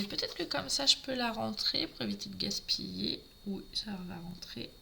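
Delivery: half-whispered aside, talking to herself; whispered to self. Real, not acted. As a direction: whisper it under your breath while working. Muttering to herself while fitting paper. (chuchoté pour soi-même)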